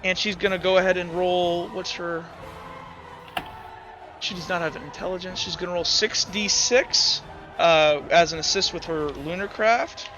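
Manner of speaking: ordinary delivery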